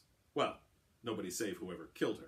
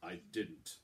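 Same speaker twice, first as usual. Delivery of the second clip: soft, tight words forced through gritted teeth; 4 semitones lower, 5 dB quieter than usual. Acted. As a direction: hold the anger back behind the teeth. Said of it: "said through gritted teeth".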